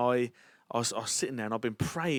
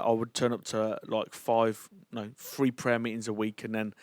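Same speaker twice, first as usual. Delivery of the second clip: ordinary delivery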